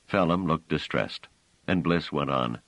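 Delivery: ordinary talk, nothing marked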